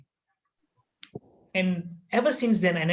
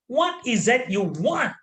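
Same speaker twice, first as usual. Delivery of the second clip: slow, higher, insistent question; intimidating demand; 6 semitones higher than usual. Retold or imitated. Master loses patience with pupil, slow and intimidating. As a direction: spell out the demand word by word. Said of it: "it made me even scared".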